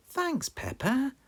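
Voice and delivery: slow, high cheerful thanks; grateful neighbour voice; dog characters voice. Acted. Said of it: "Grandpa Dog and Danny Dog said".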